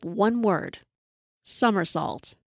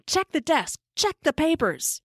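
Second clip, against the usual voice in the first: mild mock scream; 7 semitones higher than usual. A narrator's reading. Woman yelling at the narrator and partner to search, mock scream. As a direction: mimic her screamed orders without raising the volume. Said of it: "She's screaming".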